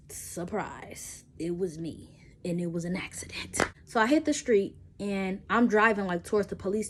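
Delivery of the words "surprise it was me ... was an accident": hushed whisper of a guilty secret; whispered confession. Acted.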